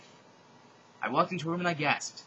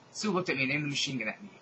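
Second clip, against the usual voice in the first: ordinary delivery, nothing marked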